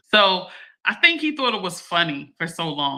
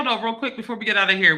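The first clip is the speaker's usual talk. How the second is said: ordinary delivery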